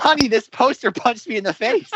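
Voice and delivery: high-pitched wounded complaint; aggrieved moviegoer bit; mock outrage. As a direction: whine to honey about the injury, hammed-up. Acted mock-outraged quote, riffing along.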